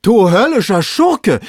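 high, loud, drawn-out outburst; exasperated yell; shouting. A narrator's reading. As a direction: bellow the insult up the tree. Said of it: "schrie Legrand".

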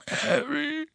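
high broken sob of grief; sobbing. Acted. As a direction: sob the name in despair. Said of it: "schluchzte Hagrid".

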